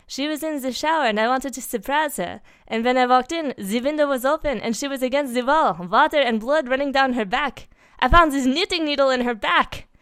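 put-on high, loud French-accented distress; upset French witness voice; distraught Frenchman. A narrator's reading. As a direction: pour it out in a panicked French accent. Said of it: "put-together Frenchman they knew".

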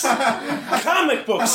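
Comic books drawn out slow and high through laughter; slow laughing repeat. Real, not acted. Comic repeats the punchline slowly to the crowd, laughing. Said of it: "repeated punchline, slowed".

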